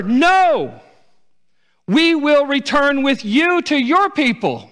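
high, loud, slow anguished cry; pleading daughters-in-law. Acted. Daughters-in-law pleading with Naomi to stay, anguished loud cry. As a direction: cry out, begging not to be sent away. Daughters weeping refuse to leave.

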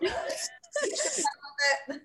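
high, slow, whimpering imitation; whiny mock voice. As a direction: whine it like a fake sob. Mimicking someone, crying.

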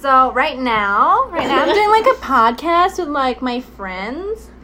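drawn-out Gen Z podcast voice with rising ends; exaggerated Gen Z English, stretched vowels. Shown as a demonstration. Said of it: "I'll talk the English way of them".

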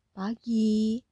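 a lightly voiced heavy, loud greeting; heavy woman's voice. A narrator's reading. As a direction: call out a weighty, loud good morning. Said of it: "suara yang berat itu". (sapaan perempuan bersuara berat)

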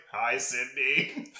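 creepy voice-changer greeting. Retold or imitated. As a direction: drag it out like a masked caller. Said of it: "voice modulator".

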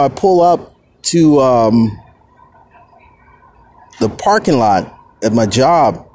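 ordinary talk, nothing marked

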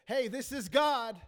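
loud, slow mock announcement; emphatic announcement. Preacher imagines God announcing himself to Samuel, emphatic announcement.

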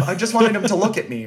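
normal talking voice

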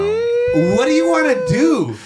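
very high, loud, drawn-out taunt; taunting challenge. Retold or imitated. Electrician retells daring the bitter shop guy, high mocking challenge.

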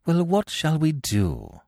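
drawn-out, exasperated plea for an answer. A narrator's reading. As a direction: ask it in mild exasperation. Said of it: "asked Algernon, exasperated".

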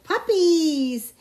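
loud, drawn-out storybook cry; excited exclamation. A narrator's reading. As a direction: burst out with a child's delight. Boy wakes and remembers the puppies.

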